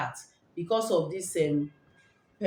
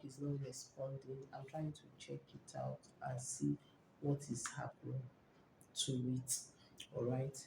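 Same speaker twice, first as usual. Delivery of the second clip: much softer, half-whispered while distracted; near whisper; 5 semitones lower, 16 dB quieter than usual. Real, not acted. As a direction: drop to a low murmur while fiddling with the phone. Checking network, voice drops away.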